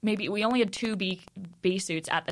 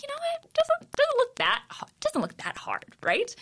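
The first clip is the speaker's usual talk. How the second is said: high, breezy overconfident quote; mock naive voice. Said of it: "And we're like".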